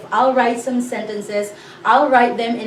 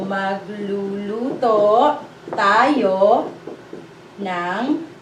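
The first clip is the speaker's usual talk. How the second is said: Magluluto tayo ng kanin drawn out, word by word; very slow repeat; 2 semitones lower, much slower than usual. Shown as a demonstration. Teacher slowly repeats Magluluto tayo ng kanin for learners to copy. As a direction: stretch every syllable out for learners.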